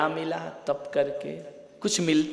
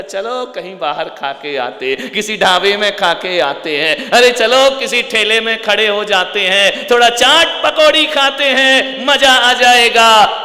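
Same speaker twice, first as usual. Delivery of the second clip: a hungry mind egging itself on, ever louder; building from chatty to loud relish; imagined craving; 5 semitones higher, 12 dB louder than usual. Retold or imitated. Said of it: "चौदस के बाद ऐसा लगेगा".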